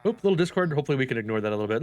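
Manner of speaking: normal talking voice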